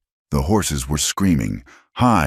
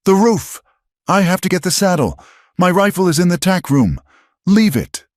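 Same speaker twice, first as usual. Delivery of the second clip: high, loud pleas; urgent yelling; 3 dB louder than usual. A narrator's reading. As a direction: shout him out of danger. Elara yelling in burning barn.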